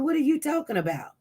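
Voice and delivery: normal talking voice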